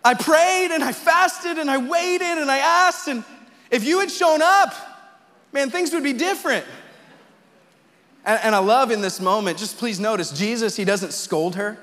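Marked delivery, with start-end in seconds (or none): 0.0-6.6 anguished complaint to God, pitched very high, easing off at the end; bitter frustration. Retold or imitated. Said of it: "you just felt like".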